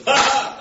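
a big bright laugh that jumps high and loud; hearty laugh. Retold or imitated. Laughing at her confusion.

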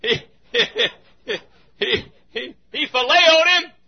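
high loud laughing stammer; laughing shout. Acted. Preacher laughing to the congregation, loud and high. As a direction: sputter it out while laughing.

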